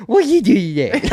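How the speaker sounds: high, loud voice for the dog, laughing. Shown as a demonstration. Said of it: "give us a little taste".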